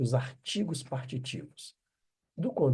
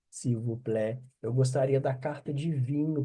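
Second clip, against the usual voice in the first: ordinary talk, nothing marked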